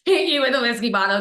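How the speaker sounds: hammed-up sincere vow between laughs; own reassuring reply. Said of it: "And it was like".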